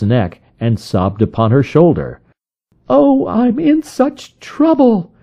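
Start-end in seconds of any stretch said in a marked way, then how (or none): 2.9-5.1 high, slow, distressed woman's voice; frightened visitor crying out in a high voice. A narrator's reading. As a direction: plead in distress, much higher and slow. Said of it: "she cried".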